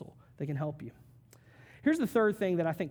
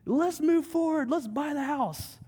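high, slow, eager push to buy now; eager rush; 9 semitones higher, 4 dB louder than usual. Retold or imitated. Impatient homebuyer urging to buy now, eager high voice. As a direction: sell it like an impatient buyer at full excitement. Eager buyer rushing the decision.

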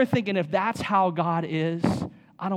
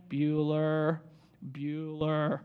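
lightly voiced droning teacher, lower and softer; boring monotone teacher; 4 semitones lower, 6 dB quieter, much slower than usual. Pastor mocks a monotone teacher taking roll, lightly droning. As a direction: call roll like a bored teacher, flat and slow. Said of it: "very boring, monotone voice".

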